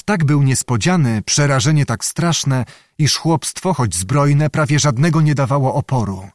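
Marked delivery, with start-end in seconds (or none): none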